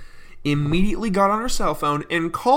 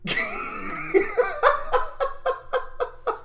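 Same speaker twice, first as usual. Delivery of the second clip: high wheezing laughter; helpless high laugh. Real, not acted. Vlogger losing it over the woman's accent, helpless laugh. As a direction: crack up uncontrollably. Cracking up at story.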